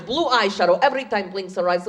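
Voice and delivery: usual speaking voice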